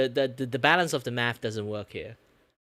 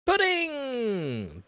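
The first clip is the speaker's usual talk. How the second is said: excited; 12 semitones higher than usual. Real, not acted. Delighted shout at the pudding.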